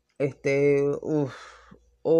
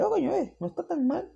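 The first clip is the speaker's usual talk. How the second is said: high, surprised mock exclamation; a high-pitched grudging 'not bad'; surprised aside; 3 dB quieter, faster than usual. Reviewer recalls grudgingly accepting the dub, high and surprised.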